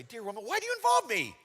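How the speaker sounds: high-pitched, put-upon protest; hammed-up annoyed complaint; mock exasperation. Shown as a demonstration. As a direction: object sharply, voice jumping up. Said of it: "you can interpret this way".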